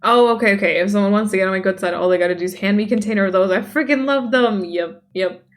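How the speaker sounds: usual speaking voice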